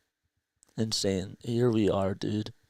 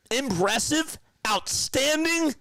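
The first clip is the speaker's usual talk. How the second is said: usual speaking voice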